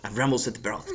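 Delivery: ordinary delivery, nothing marked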